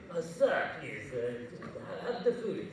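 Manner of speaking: hesitant, hushed plea with a breathy laugh; imitated train attendant. Attendant fobbing off a passenger's question, mock-polite hesitance. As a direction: placate an awkward passenger, softly. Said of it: "you're asking the guy".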